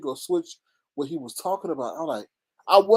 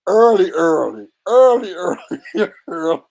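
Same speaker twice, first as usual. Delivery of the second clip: loud, punched repeats breaking into laughter; mock urgency; 7 dB louder than usual. Vlogger acting how Jay should have shown up, loud mock urgency. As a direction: call it out like an eager early bird. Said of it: "You should have been, like".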